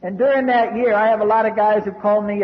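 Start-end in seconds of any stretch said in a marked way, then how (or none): none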